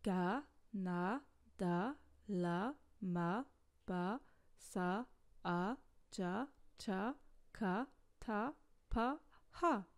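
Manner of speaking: each Korean syllable said slowly and clearly, one by one; modelled Korean syllables. Shown as a demonstration. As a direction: model the consonant syllables for learners. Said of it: "Commençons par les consonnes".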